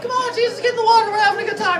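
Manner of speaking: put-on very high, excited child voices calling out; high kid voices. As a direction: squeal it like little kids at the pool. Kids calling Jesus into water.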